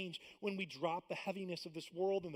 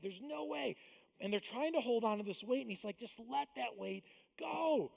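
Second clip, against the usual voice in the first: high, loud pleading sermon; full-force preaching; 5 semitones higher, 8 dB louder than usual. Acted. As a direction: urge the congregation from the pulpit.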